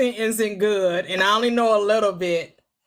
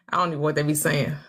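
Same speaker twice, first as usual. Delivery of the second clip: ordinary talk, nothing marked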